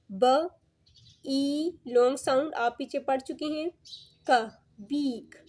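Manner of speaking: each sound alone, drawn out, then the whole word; sounding out beak. Shown as a demonstration. Teacher sounding out a dictionary word for her students, slow. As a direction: sound out ब, ई, क slowly, then blend into बीक. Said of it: "ये pronunciation की lines हैं sounds की".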